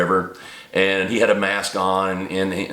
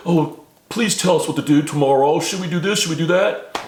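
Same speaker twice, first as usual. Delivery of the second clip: higher-pitched, mocking plea; mock pleading; 6 semitones higher than usual. Elderly followers begging their masters what to do, mock pleading. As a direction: imitate helpless followers begging for orders.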